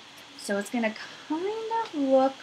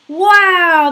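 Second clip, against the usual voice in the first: excited wow; 9 semitones higher, 14 dB louder than usual. Acted. Praising children's drawings.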